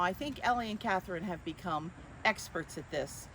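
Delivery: usual speaking voice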